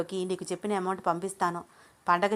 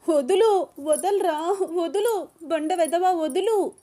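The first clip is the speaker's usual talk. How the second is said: very high, loud screams of let go; woman screaming as she is carried off; screaming struggle; 10 semitones higher, 5 dB louder, much slower than usual. Acted. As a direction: thrash and shriek at him. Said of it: "Ananya తెగ గింజుకుంటోంది".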